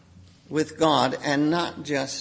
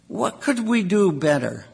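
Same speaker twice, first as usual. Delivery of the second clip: ordinary talk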